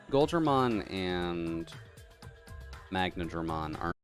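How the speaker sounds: ordinary talk, nothing marked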